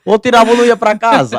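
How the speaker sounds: high, quick burst over laughter; loud laughing ultimatum. Retold or imitated. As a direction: blurt out dad's ultimatum, amused. Retelling father's ultimatum, laughing. (ultimato em voz alta, rindo)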